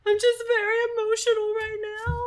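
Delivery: high, loud weepy mom voice; hammed-up tearful mother; mock sobbing mom. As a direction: squeeze it out like a mother fighting tears. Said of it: "And she's like".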